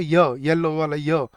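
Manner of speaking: slow, isolated "य" as yuh; y as in yellow. Shown as a demonstration. Teacher showing students the first sound, slow "य" as in "येलो". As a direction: draw out "य", the glide that starts "येलो". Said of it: "य की sound आ रही है".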